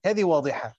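ordinary delivery

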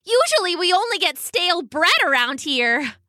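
high, perky duck voice; chirpy duck. A narrator's reading. The duck chatters to Bailey in a high, perky voice.

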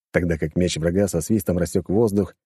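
ordinary delivery, nothing marked

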